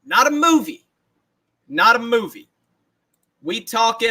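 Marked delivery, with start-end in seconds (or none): 0.1-2.5 Allen Iverson rant. Retold or imitated. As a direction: bark it like the famous press conference. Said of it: "Jeremy Iverson".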